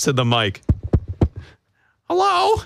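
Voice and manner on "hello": very high, cracking squeak; mock a timid, voice-cracking hello; Adam Abdallah. Co-host imitates his partner stepping to the mic, cracking squeak. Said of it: "Why is my voice cracking?".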